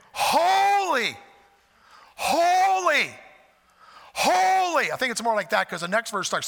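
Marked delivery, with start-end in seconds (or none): 0.1-4.9 high, loud, ringing out; loud proclaiming holy. Shown as a demonstration. Preacher showing angels crying out loud. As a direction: cry each holy out full voice. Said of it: "Or it could have been".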